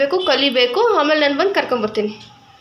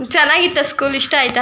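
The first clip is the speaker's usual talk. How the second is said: ordinary talk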